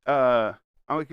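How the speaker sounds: ordinary talk, nothing marked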